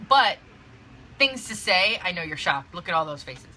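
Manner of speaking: usual speaking voice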